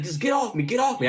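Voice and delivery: mock yelling at his brother to let go; retold yell. Pinned by his brother in the shower, desperate yell.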